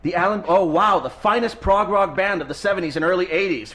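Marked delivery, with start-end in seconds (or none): none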